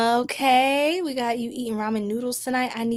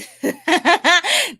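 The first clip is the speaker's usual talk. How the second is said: loud, high burst of laughter; real high, loud laugh; 8 semitones higher, 6 dB louder than usual. Real, not acted. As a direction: crack up at your own attempt. Laughs at her own Spanish.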